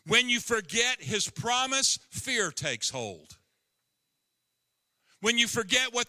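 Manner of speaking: high, loud preaching climax; preaching at full force. Acted. Preacher warning his church not to forget, loud and high. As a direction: press the warning on the congregation.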